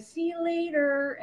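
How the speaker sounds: a light mom send-off, sing-songy; casual mom goodbye. Retold or imitated. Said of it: "my mom would be like".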